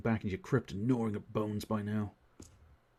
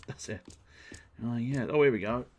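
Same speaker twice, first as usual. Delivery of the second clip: usual speaking voice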